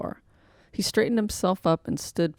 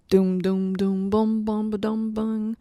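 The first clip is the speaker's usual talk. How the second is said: band sounds; 3 semitones higher than usual. Acted. Band playing on.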